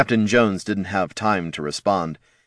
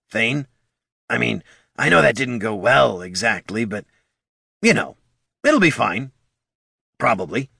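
nervous kobold reassuring; 3 semitones higher than usual. A narrator's reading. Bert comforting wounded Thane, nervously. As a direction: reassure the troll, not believing it.

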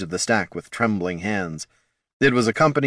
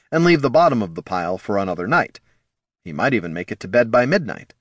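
ordinary delivery, nothing marked

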